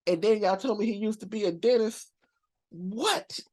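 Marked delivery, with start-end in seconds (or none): 2.7-3.4 high, rising one-word disbelief; incredulous. Real, not acted. Told he used to be a dentist, incredulous high rise. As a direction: let it leap upward in disbelief.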